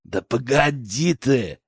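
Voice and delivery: slow, put-on villain brush-off; dismissive. A narrator's reading. Poacher brushing off his accomplice outside, dismissive. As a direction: wave away the impatient partner. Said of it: "отмахнулся тот".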